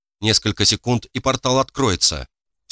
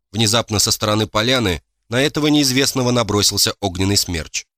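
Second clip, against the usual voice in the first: ordinary delivery, nothing marked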